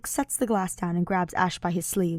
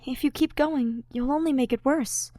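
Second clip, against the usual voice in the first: higher, gentle voice; higher, caring friend; Ash caring; 7 semitones higher than usual. Acted. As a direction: coax him to stop.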